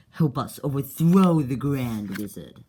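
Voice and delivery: loud, slow grand call; a put-on booming voice; booming demand. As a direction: proclaim it like a rebel leader. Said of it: "Clockwise is like".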